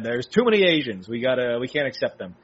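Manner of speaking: normal talking voice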